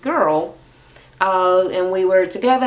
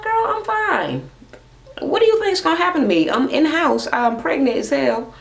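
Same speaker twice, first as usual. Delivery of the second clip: exasperated sister; 8 semitones higher than usual. Retold or imitated. Retold sister brushes off the worry.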